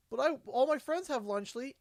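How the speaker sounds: high, whining protest; lightly voiced child pleading; whiny kid. Child pleading with mom, high and whiny. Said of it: "kid arguing with mom".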